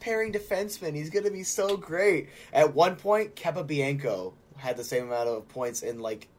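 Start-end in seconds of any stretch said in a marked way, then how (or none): 0.0-2.3 high, gushing fake enthusiasm; mock hype. Host jumps in to tease about a player, high mock hype. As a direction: gush like an overexcited fan.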